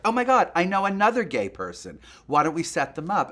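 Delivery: high, loud, eager burst; excited retold quote. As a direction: imitate a cousin bursting with an idea. Excited cousin's idea, retold.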